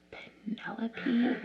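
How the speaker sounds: slow, breathless whispered question; shocked whisper. Retold or imitated. Vlogger reliving her shock at the reveal, whispering. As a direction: gasp the name out in disbelief.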